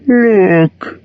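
dragon showing off; proud dragon. A narrator's reading. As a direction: call the hobbit to admire. Dragon shows his belly.

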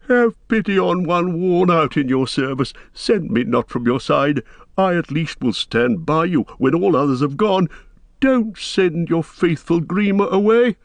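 high, pleading servile voice; wheedling counselor, pitch climbing at the end; whining. A narrator's reading. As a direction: grovel and beg not to be sent off. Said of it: "whined Wormtongue, grovelling on the ground".

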